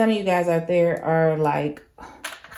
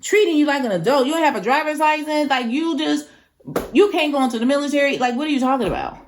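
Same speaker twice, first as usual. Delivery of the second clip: higher, fast, fed-up rant; rapid, exasperated scolding pitched higher; 7 semitones higher, faster than usual. Real, not acted. Ranting back at the son.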